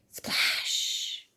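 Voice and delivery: splash as a long hushed whoosh; whispered splash effect. Acted. Pig hits the water, drawn-out breathed splash. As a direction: let the word dissolve into a long shh.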